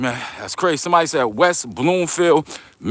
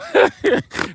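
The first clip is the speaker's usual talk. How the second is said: high burst of laughter. Real, not acted.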